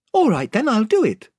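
a lightly voiced, firm young girl; a girl agreeing bravely; brave Lucy. A narrator's reading. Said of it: "said Lucy".

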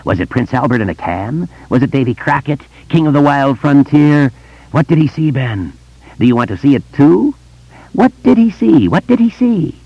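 high sing-song taunt climbing to a screech; screaming, gleeful vampire. A narrator's reading. Vampire taunting Ben from the landing, rising to a shrill screech. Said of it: "laughing through the bloody hole of its mouth".

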